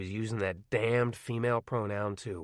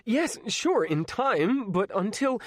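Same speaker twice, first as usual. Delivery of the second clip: much higher and louder, rushed; a teen pleading urgently; desperate interruption; 12 semitones higher, 5 dB louder, slower than usual. Acted. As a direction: cut in, pleading. Arnie cuts in desperately.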